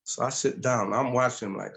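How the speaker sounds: ordinary talk, nothing marked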